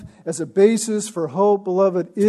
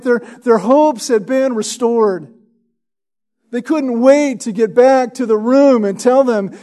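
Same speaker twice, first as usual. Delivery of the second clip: louder, higher, rising preacher's fervour; fervent preaching; 6 semitones higher, 6 dB louder than usual. Acted. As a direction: preach it with joyful excitement.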